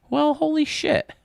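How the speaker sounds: high, slow, surprised exclamation. Real, not acted. Shocked by chat's Rangers job.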